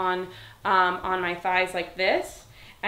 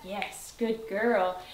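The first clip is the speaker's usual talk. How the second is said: slow praise; much slower than usual. Real, not acted. Trainer calmly praising her dog. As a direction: draw it out to keep her settled.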